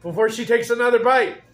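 loud, bright call to viewers; excited vlogger intro. Real, not acted. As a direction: hype up the audience.

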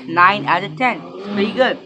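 talking while chewing food; speaking between bites; mouth full, chewing. Real, not acted. Seen 2: Bites pizza at 1.5s and 3.2–3.6s, then chews with closed mouth.

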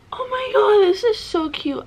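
high, loud squeal of delight; excited squeal. Real, not acted. Unwrapping a cute plushie from a fan, excited squeal. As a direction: squeal like you just saw a puppy.

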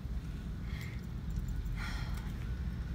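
soft, shaky breath out to steady herself; emotional sigh. Real, not acted. Overwhelmed by her product in a store, a tearful sigh. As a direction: let out a moved, tearful sigh. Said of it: "trying so hard not to cry".

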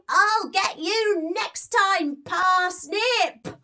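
high, slow mouse vow; slow high squeaky threat; villain mouse threat. Acted. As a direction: shake a tiny fist as the mouse.

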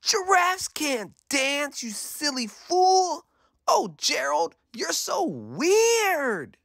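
very high, drawn-out taunt; sneering. A narrator's reading. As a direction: taunt him slowly in a high mocking voice. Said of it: "the animals all sneered".

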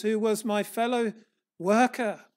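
usual speaking voice

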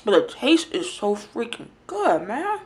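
ordinary talk, nothing marked

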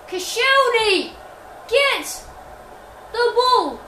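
high, drawn-out urgent call. Real, not acted. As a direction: yell slowly at a player from afar. Urging player to win ball.

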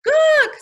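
very high, loud penguin cry. A narrator's reading. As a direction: cheer it as a penguin chorus. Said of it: "said all ten Penguins".

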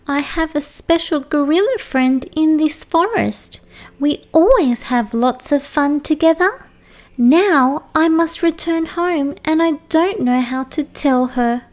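put-on young okapi, a little upset; sad okapi voice.

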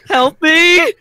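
high, loud cry for help; mock plea. Gamer pleading over an addiction, mock-desperate high yell. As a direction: call out in hammed-up desperation.

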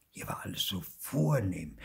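usual speaking voice

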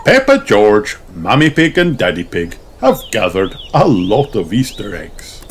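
ordinary delivery

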